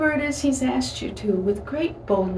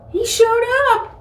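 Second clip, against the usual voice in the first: high, loud burst of wonder; amazed exclamation; 14 semitones higher, 5 dB louder than usual. Real, not acted. Preacher telling how God came through, amazed exclamation. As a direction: proclaim it with delighted astonishment.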